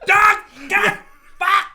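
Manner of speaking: mock midnight street yelling. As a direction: shout it into the night road. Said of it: "he's like".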